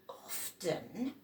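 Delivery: ordinary delivery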